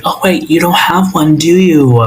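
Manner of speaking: high-pitched fake realization; mock-sweet jab in a high voice; mocking taunt. Acting out Angela taunting the girl.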